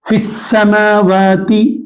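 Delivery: फिस्समावाति drawn out, फ joined to a doubled स; slow recitation. Shown as a demonstration. Teacher slowly models फिस्समावाति for the class. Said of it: "इसी तरीके से".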